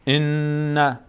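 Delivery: ان with a long humming ن; doubled nun on ان. Shown as a demonstration. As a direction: hold the doubled ن in ان. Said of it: "اینجا تشدید داریم روی نون".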